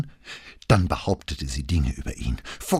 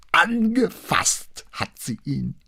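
higher, slow, appalled; horrified; slower than usual. A narrator's reading. Wife tells the detective about the girl, horrified, higher and slow. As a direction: recoil from the words in horror. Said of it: "klang blankes Entsetzen aus ihrer Stimme".

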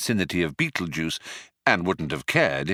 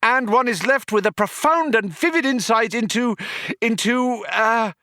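very high, louder then stalling; crescendo giving out; 14 semitones higher, 3 dB louder than usual. Acted. Arthur peaks then suddenly runs out of words. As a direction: peak then run dry. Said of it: "suddenly gave out on him".

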